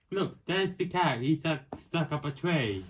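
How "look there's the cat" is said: ordinary delivery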